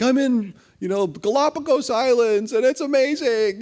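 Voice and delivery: high, loud, delighted boasting; retold friend raving about the Galapagos, high and loud; gushing. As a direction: sell the thrill of the trip. Friend calls gushing about travels.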